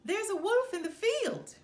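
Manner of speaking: high, loud urgent call; a boy faking panic, loud; alarm shout. A narrator's reading. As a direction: holler the warning again. Boy's false alarm shout.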